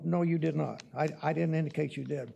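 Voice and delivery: ordinary talk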